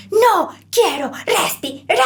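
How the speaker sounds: put-on high, slow wailing little kid; crying toddler. Three-year-old refusing to breathe, high and crying. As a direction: wail it out like a three-year-old mid-tantrum. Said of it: "El niño que responde".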